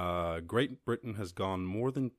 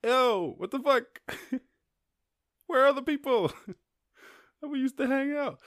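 much higher, louder squeaky dolphin, baffled and cross; indignant dolphin voice; 8 dB louder than usual. Pretend. Podcaster voices a lonely dolphin, high, squeaky and outraged. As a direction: play a dolphin demanding where the people went. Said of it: "the dolphin would be like".

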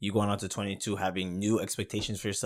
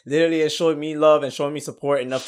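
stressed and excited; 7 semitones higher, 10 dB louder than usual. Real, not acted. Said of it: "how much I am stressing right now".

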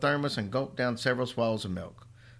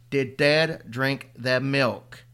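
very slow, louder, flat insistence; stubborn repeat; 3 semitones higher, 6 dB louder, much slower than usual. Acted. Boy repeating his question to his mother, stubborn and slow. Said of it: "his old stubborn self".

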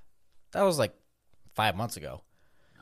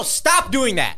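exasperated; 10 semitones higher, 6 dB louder, much slower than usual. Real, not acted. Exasperated at friend.

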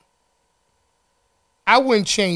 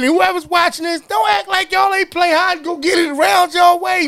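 calling out; 9 semitones higher, 4 dB louder than usual. Real, not acted. Calling out the audience.

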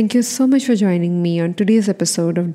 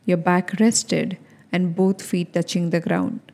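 softer, measured meditation voice with long pauses; guided meditation; 4 dB quieter than usual. Acted. Host leads listeners through calming their anxiety, guided meditation. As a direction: guide them unhurriedly, leaving room to breathe.

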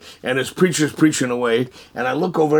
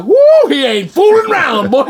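loud, high whoop of alarm; comic alarm; 12 semitones higher, 8 dB louder than usual. Retold or imitated. Man jumps at God's order, comic alarm. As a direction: yelp it, startled and half laughing. Reaction to God's sharp command.

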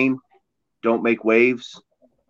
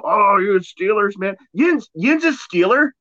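higher-pitched, hammed-up Pittsburgh fan voice; Pittsburgh accent; 5 semitones higher than usual. Imitating Pittsburgh fans asking for an autograph, mock Pittsburgh accent. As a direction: put on a broad Pittsburgher accent, high-pitched and excited. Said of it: "Did you go Cali accent at the end?".

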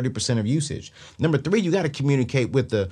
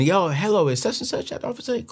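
higher, fast fake-friendly phone caller; debt collector on the phone; 8 semitones higher, faster than usual. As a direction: play the sly collector calling the office. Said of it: "I would call ... ask to speak".

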